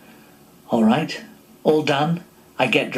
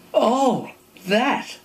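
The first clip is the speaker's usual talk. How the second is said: panicked; 9 semitones higher than usual. Retold or imitated. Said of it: "now truly panicked".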